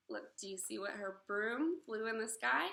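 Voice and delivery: ordinary delivery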